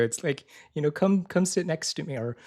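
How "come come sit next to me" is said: higher, gentle coaxing tone; loving invitation. Retold or imitated. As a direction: coax them closer like a parent. Inviting, voiced as a loving parent.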